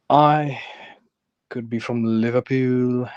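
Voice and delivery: put-on Liverpool accent, place name drawn out; jokey Scouse impression. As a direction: play up being a Liverpudlian in broad Scouse. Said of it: "doing an accent for no".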